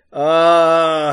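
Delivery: long, loud groan; drawn-out groan of discomfort; pained groan. A narrator's reading. Man with a sore head, groaning. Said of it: "Henry groaned".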